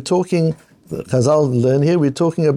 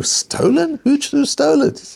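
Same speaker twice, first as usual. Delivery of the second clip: much higher, incredulous questions; shocked disbelief; 12 semitones higher than usual. Acted. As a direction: sound aghast that the watch is gone. Owner shocked his Rolex stolen.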